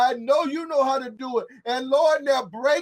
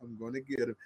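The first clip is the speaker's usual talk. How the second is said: much lower and softer, a hushed divine vow; quiet, low voice of God answering; God's quiet promise; 11 semitones lower, 14 dB quieter than usual. Acted. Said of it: "But he said".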